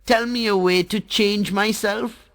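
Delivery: put-on high, sweet child voice, flattering; butter up the Sun in a high bright voice; little water drop sweet-talking the Sun, voiced high; Jal the water drop.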